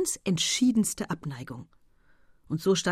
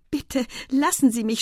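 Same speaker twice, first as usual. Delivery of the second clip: raised, spluttering mock protest; playful indignation; 6 semitones higher, 3 dB louder than usual. A narrator's reading. Estelle protests to her admirer, playfully indignant. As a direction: play at being a little outraged. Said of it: "spielte ein bisschen die Entrüstete".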